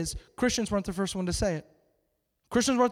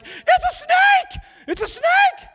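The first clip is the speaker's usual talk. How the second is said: shrieked alarm, extremely high and loud; freaked out; 7 dB louder, much slower than usual. Acted. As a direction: play a blind man panicking, hammed-up screech. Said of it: "he's just freaked out".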